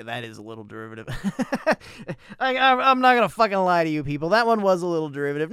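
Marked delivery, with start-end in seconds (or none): 1.1-2.3 short real laugh. Real, not acted. Laughs at own remark. 2.4-5.5 loud, higher, laughing emphasis; emphatic outburst. Real, not acted. Emphatic confession after laugh.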